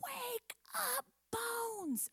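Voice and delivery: high, drawn-out soft-volume call; mock shout. Shown as a demonstration. Teacher showing kids how to wake Bones, high mock shout. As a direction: model a shout without the loudness. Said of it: "and shout really loud".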